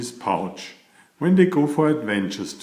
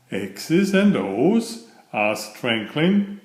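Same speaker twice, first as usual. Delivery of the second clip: gentle storybook read-aloud; storyteller voice for a children's picture book; 2 semitones higher, 3 dB louder than usual. A narrator's reading. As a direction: read it like a bedtime story to little ones.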